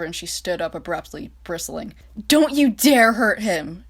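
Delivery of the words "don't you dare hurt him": slow, louder, higher furious snap; snapping. Acted. France furious, warning Reich off Britain. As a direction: spit each word, slow. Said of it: "she snapped at him".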